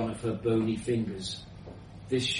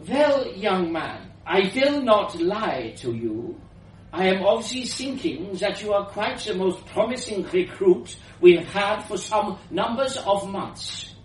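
put-on higher, powerful old woman voice with foreign lilt; grand old inventor, slightly foreign; elderly foreign woman; 7 semitones higher, 3 dB louder than usual. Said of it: "elderly woman's voice, powerful and slightly foreign".

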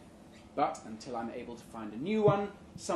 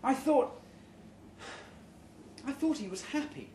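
hurt disbelief; 9 semitones higher than usual. Acted. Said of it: "I finally say".

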